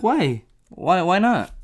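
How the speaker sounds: lightly acted puzzlement; puzzled questioning. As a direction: act out mild bewilderment, pitch lifted. Retold puzzled reaction to friends.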